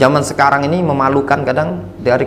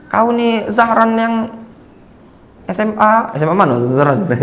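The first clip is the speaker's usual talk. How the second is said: imitated old schoolmate; 8 semitones higher than usual. Menirukan kawan sekolah menyapa Zahran.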